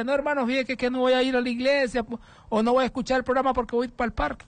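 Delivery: high-pitched excuse-maker; hermano Luis Leonel García. Acted. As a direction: mimic a churchgoer begging off, pitched high. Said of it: "digamos, el hermano Luis Leonel García". (excusa fingida, en voz aguda)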